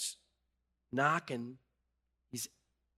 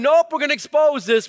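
God's loud, high, emphatic verdict; 13 semitones higher, 15 dB louder than usual. Retold or imitated. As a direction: declare it as God, full force. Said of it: "And God's going".

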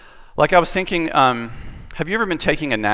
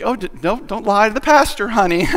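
higher-pitched, acted-out embarrassed mom; mock motherly scolding, pitched up; shooing mother; 7 semitones higher, 3 dB louder than usual. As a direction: hurriedly shush your child in front of the pastor. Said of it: "sort of shooing him away".

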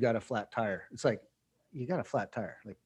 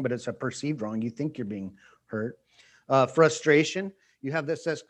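normal talking voice

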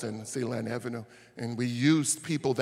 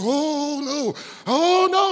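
lightly voiced fretful priest, higher; a hint of a worried priest backing off; priest's voice; 13 semitones higher, 6 dB louder, much slower than usual. Priest deciding not to help, mild fretful voice. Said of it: "He said".

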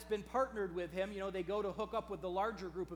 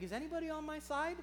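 much higher, plaintive questioning voice; plaintive plea; 7 semitones higher, faster than usual. Acted. Someone finding themselves in a lonely situation, plaintive plea. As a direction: cry out for an ally, high-pitched.

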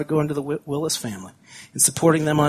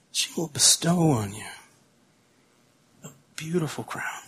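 tender hushed echo; 8 dB quieter than usual. Real, not acted. Pastor echoes verse tenderly.

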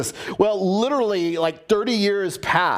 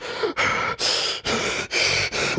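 heavy breathing. Retold or imitated. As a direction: imitate heavy, rasping breaths down the phone. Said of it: "he was talking to me like this".

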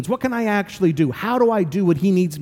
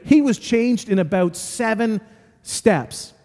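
usual speaking voice

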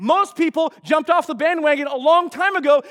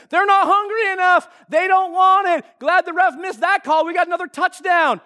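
higher-pitched mock sideline yelling; imitated heckling yell; 5 semitones higher than usual. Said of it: "she's not just yelling for her team".

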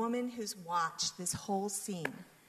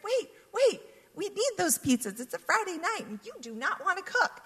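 much higher, louder, urgent pleading; excited plea; 11 semitones higher, 4 dB louder than usual. Retold or imitated. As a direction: rush in to save the pizzas. Stopping manager taking pizzas.

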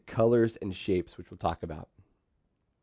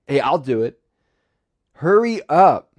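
loud, high bossy command; barking out orders to the group; 5 semitones higher, 9 dB louder than usual. Retold or imitated. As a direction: hurry them along like the boss. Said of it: "bold, bossy, confident, or controlling words".

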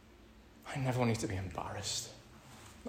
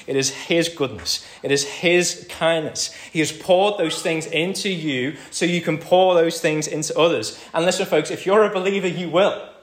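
louder, higher, building sermon cry; preaching at full force; 7 semitones higher, 11 dB louder than usual. Acted. Pastor urging the congregation, preaching at full force. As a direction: drive it home to the congregation.

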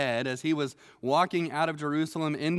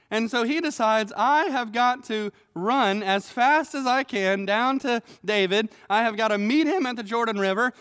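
higher, louder mock panic rising to begging; hammed-up fear of a man who knows he's doomed; panicked Shimei; 6 semitones higher, 5 dB louder than usual. Shimei realizes David is king again, mock panic. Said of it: "Shimei realizes".

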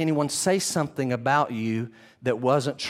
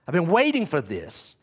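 normal talking voice

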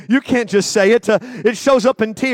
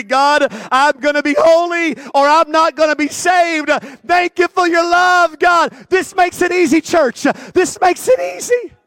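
loud, high fiery preaching; shouted altar call; 6 semitones higher, 4 dB louder than usual. Acted. Preaching at full force.

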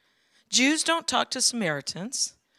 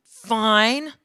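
clipped mad reply; a touch of a rude cashier; curt mad; 3 semitones higher, 7 dB louder than usual. Retold or imitated. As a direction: bite off the word like a cross cashier. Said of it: "And she was like".

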